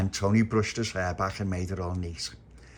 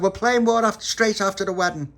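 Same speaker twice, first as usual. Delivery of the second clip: loud reply; 12 semitones higher, 7 dB louder than usual. Acted. Polly calls back about war.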